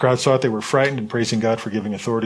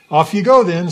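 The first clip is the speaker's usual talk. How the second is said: light, offhand send-off, higher and louder; breezy and casual; 7 semitones higher, 7 dB louder than usual. Shown as a demonstration. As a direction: toss it off like it's no big deal. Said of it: "It just makes it seem like, simple".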